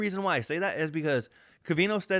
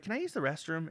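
softer, overly polite request; put-on sweet nice-guy voice; 7 dB quieter than usual. Begging the clerk for the bathroom, soft and sweet. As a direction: ask it as sweetly as possible.

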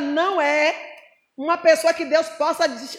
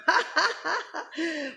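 short, quieter laugh; 6 dB quieter than usual. Real, not acted. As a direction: laugh after the warning. Preacher's laugh after the warning. (risada curta)

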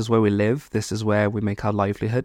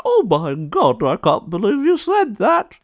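high-pitched scandalised gasping voice; hammed-up horror at a rude word; mock outrage; 8 semitones higher, 3 dB louder than usual. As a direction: imitate someone up in arms, pitched way up. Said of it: "like, up in arms about it".

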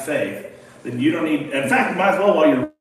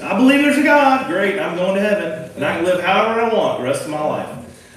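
mocking careless believer; 5 semitones higher, 4 dB louder than usual.

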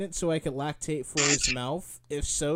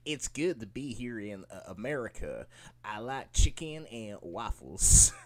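put-on British take on Southern accent; 6 semitones lower, 5 dB quieter than usual. Imitating a British dude faking American, Southern accent. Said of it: "assume we're all fucking Southerners".

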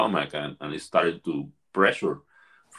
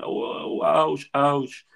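mock pained screaming; 5 semitones higher, slower than usual. Facilitator imitates a participant in pain, mild mock scream. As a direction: imitate a man crying out, held back. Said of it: "the guy starts screaming".

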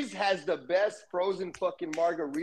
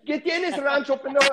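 teasing; 9 semitones higher, 4 dB louder than usual. Acted. Teasing in Spanish, high.

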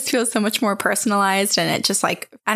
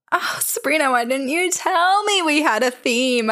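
mock outrage; 5 semitones higher than usual. Real, not acted. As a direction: tease her for not warning. Playful mock complaint.

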